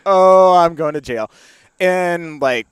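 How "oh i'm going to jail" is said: mock doom. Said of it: "And I was like".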